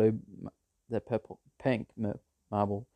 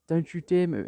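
mock order; 8 semitones higher, 4 dB louder than usual. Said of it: "And it says".

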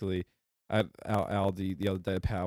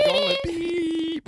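very high, loud mock beeping; imitated horn; 7 dB louder, much slower than usual. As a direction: squeal it like a vehicle horn. Imitates pallet jack horn.

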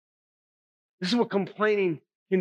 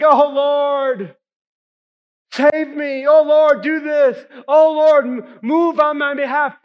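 mock whining prayer; 6 semitones higher, 8 dB louder, slower than usual. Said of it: "sometimes we're just like".

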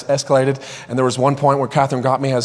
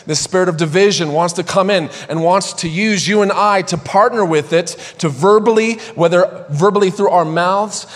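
preaching at full force; 3 semitones higher than usual. Acted. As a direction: build it like a fiery preacher. Preaching against division, voice rising.

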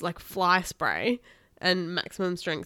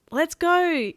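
high, loud storybook yell; 9 semitones higher, 6 dB louder than usual. A narrator's reading. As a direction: urge a friend to flee. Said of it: "Kenny yelled".

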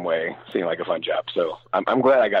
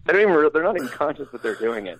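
ordinary talk

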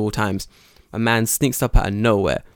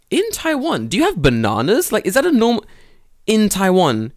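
high, loud faux-innocent question; mock innocent; 9 semitones higher, 5 dB louder than usual. Teacher baits Tanaka sensei about bananas in Taiwan. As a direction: ask it brightly to needle him. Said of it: "Tanaka Sensei".

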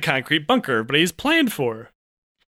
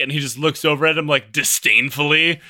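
usual speaking voice

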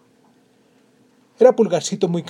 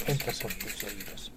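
much softer, lower echo; 5 semitones lower, 12 dB quieter than usual. Acted. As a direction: let it fall away softly. Podcast intro repeated line.

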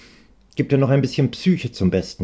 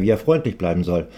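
ordinary delivery

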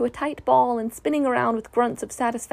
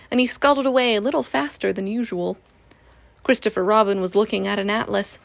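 ordinary delivery, nothing marked

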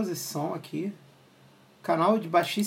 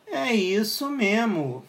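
slow, drawn-out mic test line; 4 semitones higher, much slower than usual. Shown as a demonstration. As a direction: stretch every word out slowly. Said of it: "Será que ficou uma merda o som?".

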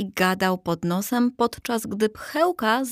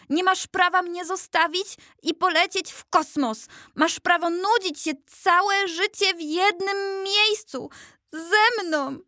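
high-pitched angry scolding of a friend; angry tirade; 9 semitones higher than usual. Acted. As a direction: tear into him, outraged. Furious friend berates the statue.

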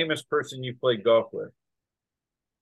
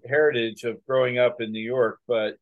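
normal talking voice

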